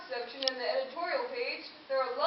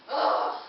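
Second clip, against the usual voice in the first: frustrated groan; 6 dB louder than usual. Acted. Ball stuck in the tree.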